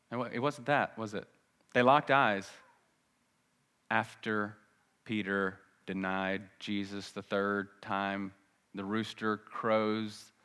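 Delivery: usual speaking voice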